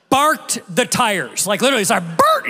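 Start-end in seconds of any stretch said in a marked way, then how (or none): 2.2-2.5 loud high squealing tire noise; tire screech. Retold or imitated. Pastor imitating the tires as the car shot forward, loud screech. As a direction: screech it like rubber on concrete.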